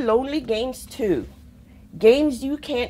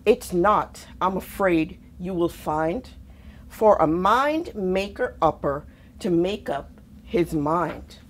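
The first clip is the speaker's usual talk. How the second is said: plain reading voice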